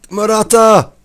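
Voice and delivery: high, loud call of the name; real shout; excited shout. Real, not acted. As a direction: yell him on. Shouts as Morata runs through.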